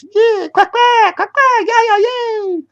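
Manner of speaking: very high squeaky cheering quacks; freed ducks celebrating their hero. Acted. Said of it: "The scared ducks ... cheered".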